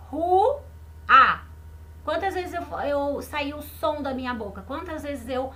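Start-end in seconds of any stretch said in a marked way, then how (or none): 0.1-0.6 "Rua" raised and loud, split ru, pause, a; syllable by syllable. Shown as a demonstration. Teacher asking pupils to watch her mouth, "Rua" split in two. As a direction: show each mouth opening in "Rua": ru, then a. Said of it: "Olha a boquinha da Pro".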